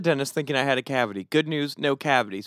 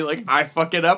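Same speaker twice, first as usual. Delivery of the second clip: ordinary delivery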